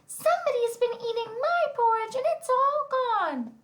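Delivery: very high, squeaky little bear; Baby Bear. Acted. Narrator voicing the tiniest bear whose porridge is gone, high squeaky voice. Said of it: "has the highest, squeakiest voice".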